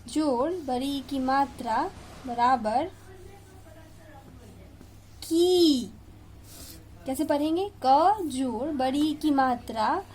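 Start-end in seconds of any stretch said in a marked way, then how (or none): 5.2-5.9 the long ई in की drawn out and loud. Shown as a demonstration. Teacher to children, drawing out की loud and long. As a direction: stretch की out, loud and firm. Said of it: "इसे हम जोर से और थोड़ा लंबा पढ़ेंगे".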